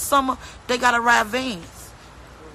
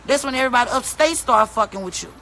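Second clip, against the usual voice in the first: normal talking voice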